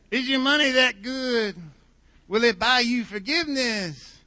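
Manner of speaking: high, slow, nasal-free Dylan drawl impression; sing-speak it like [name], high and drawn out; [name] accent. Pastor quoting [name] to the congregation in a [name] accent. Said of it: "do it with a [name] accent".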